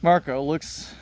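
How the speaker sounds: ordinary talk, nothing marked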